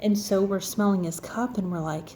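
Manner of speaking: ordinary delivery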